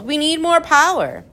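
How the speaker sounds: high, urgent call. A narrator's reading. Professor wants jokes to power the boat, calling out. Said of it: "he called".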